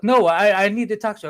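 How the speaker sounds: mock cop insisting. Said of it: "He's like".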